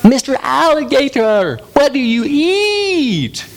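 very high, drawn-out cartoon frog; wide-mouthed frog. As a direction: stretch every word wide like a big-mouthed frog. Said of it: "there's a wide-mouthed frog".

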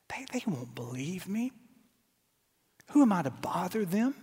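soft, low echo of his excuse; murmured confession; quoting Moses' excuse under the breath; hushed Moses quote. Acted. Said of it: "the same excuses".

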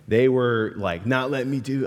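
ordinary talk, nothing marked

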